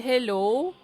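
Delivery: ordinary delivery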